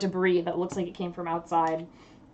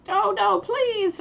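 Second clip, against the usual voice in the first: high-pitched, louder plea for help; struggling victim; 12 semitones higher, 4 dB louder, slower than usual. Acted. Game master voices a victim in the cave, pleading. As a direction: cry out like someone being dragged off. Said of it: "sounds like a person struggling".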